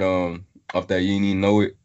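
usual speaking voice

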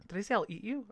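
normal talking voice

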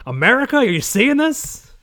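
loud, hammed-up complaint rising high; playful over-the-top indignation; mock outraged ranter. Mock rant about stolen jobs.

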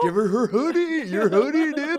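high, loud teasing bro voice. Pretend. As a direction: play a pushy frat bro egging him on. Mock bro voice repeated.

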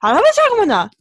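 loud, high garbled robot speech; mock fast app voice. Mocking the Duolingo app voice, a loud high gabble. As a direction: rattle it off like a speedy app voice. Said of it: "the person literally just goes".

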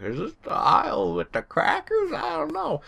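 put-on high, dithering old couple voice; old lady voice. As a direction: mimic a confused elderly shopper.

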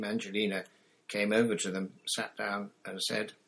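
plain reading voice

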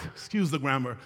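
ordinary talk, nothing marked

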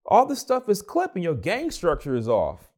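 exasperated critique. Retold or imitated. Acts out scolding bad engineers.

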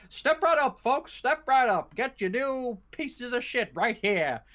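high, slow carnival barker; snake oil seller. Retold or imitated. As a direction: call out like a fairground huckster. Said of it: "and he's like".

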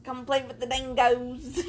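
lightly put-on Aussie drawl; Australian accent. Mocking her own attempt at an Australian accent for her co-host. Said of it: "I can't do accents".

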